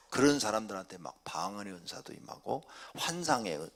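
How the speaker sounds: ordinary talk, nothing marked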